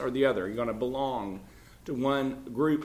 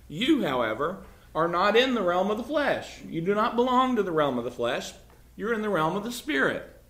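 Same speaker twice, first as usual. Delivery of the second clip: raised, forceful declaration; emphatic; 5 semitones higher, 3 dB louder than usual. Acted. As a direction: drive each clause home at a higher pitch. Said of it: "Paul says quite emphatically".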